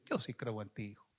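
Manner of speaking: God gently reassuring a rejected son; tender God voice. Acted. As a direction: whisper comfort to a hurting child. God speaks tenderly to believer.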